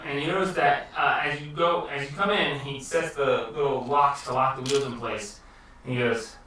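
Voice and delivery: normal talking voice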